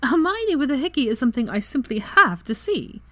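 high-pitched, gleeful delight; tearfully amused. A narrator's reading. Friend after rolling with laughter, tearfully amused. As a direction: relish it, pitch lifted with amusement. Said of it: "wiping tears from her eyes".

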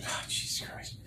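breathless whispered exasperation. Real, not acted. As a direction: whisper it to yourself. Exasperated aside.

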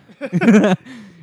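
sudden high, loud laugh; big amused laugh; loud burst of laughter. Real, not acted. Laughing at own understatement.

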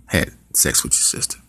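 put-on low hushed boyfriend; soft low admission; mumbled repeat. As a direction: mumble it guiltily.

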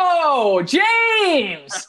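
high, loud thrilled whoa; excited shout. Real, not acted. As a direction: cheer him on loudly. Excited over correct guess.

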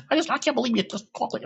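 high-pitched, fast mock scolding; imitated angry mom. Mom cussing her son out one day, high mock-angry rant. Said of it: "she started cussing me out one day".

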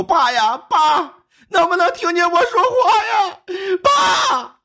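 high, drawn-out mock wailing cries; wailing calls. Relatives calling to dying father, high wailing shouts. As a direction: call out over and over, pitch far up. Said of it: "在一声声的搁那喊".

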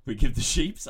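high, loud repeat of SHEEPS; mocking own slip. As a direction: burst out incredulously with the wrong plural SHEEPS. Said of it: "I literally just said sheeps".

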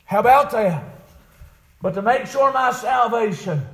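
high, loud revival preacher; impassioned preaching. Acted. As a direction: preach it urgently at full voice. Preaching at full force.

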